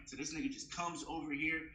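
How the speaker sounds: ordinary talk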